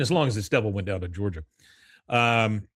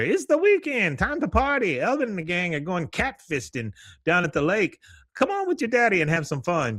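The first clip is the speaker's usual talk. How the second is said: dad's hillbilly party voice; 8 semitones higher than usual. Retold or imitated. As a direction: sing it out like a rowdy dad inviting the kid to party. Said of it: "you hear".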